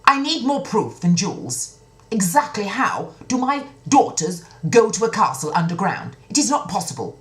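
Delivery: high, loud demanding outburst; indignant king. Acted. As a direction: insist it cannot be true. King demands more proof.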